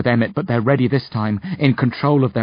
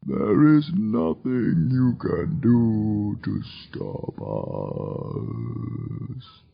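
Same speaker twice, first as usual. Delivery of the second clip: mournful demon; 3 dB quieter, much slower than usual. A narrator's reading. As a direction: lament mortal ignorance slowly. Demon keeps threatening.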